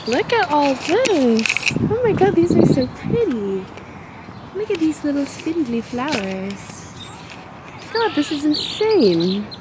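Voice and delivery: high, slow gushing wonder; awed excitement. Real, not acted. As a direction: marvel at every flower. Walking among pretty flowers.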